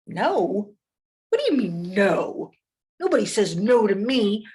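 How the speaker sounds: loud, incredulous outburst; affronted bully who can't believe he was refused; swooping, emphatic outrage; indignant wolf. A narrator's reading.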